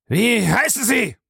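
high, sharp demand; angry snapping. A narrator's reading. Old master demands the butler's name, snapping angrily. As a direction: snap the question at him. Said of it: "fuhr ihn der Alte an".

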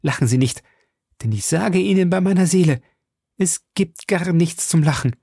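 urgent, fast protest rising in pitch; solemn alarm. A narrator's reading. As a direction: insist earnestly, shaken with dread. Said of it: "mit einem solchen Ausdruck des Schreckens".

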